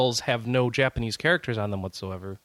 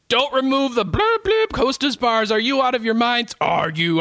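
outraged fan; 8 semitones higher, 4 dB louder than usual. Retold or imitated. Reading an outraged fan comment.

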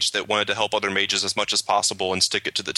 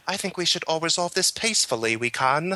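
good Hawke; 6 semitones higher than usual. Retold or imitated. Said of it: "and he's like".

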